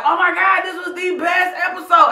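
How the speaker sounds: high, loud "Oh my God, this was the best episode"; mock gushing viewers. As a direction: gush like a thrilled fan. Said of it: "you all will be like".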